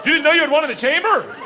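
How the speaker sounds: hammed-up high outrage; mock outrage. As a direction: protest it all in shrill disbelief. Indignant rant at wife.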